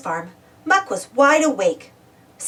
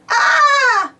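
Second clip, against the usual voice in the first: high, loud acted shriek; frightened scream; 14 semitones higher, 6 dB louder than usual. A narrator's reading. Muck scared by Spud's noises, screaming. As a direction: scream in cartoon terror. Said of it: "Muck screamed".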